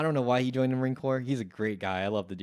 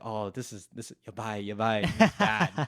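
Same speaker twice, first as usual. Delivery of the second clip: put-on Japanese accent; 3 dB louder than usual. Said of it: "in a Japanese accent, of course".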